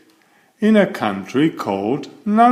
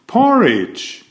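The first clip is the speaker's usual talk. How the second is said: high, bright-eyed announcement of the answer; playful reveal; 11 semitones higher, 3 dB louder than usual. A narrator's reading. Narrator teasing young listeners with a silly answer, high playful reveal. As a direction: pop the word up high like a surprise.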